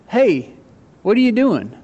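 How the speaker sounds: sassy little clay pot; mock indignant jar. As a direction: talk back to your maker, affronted. Clay jar talking back to maker.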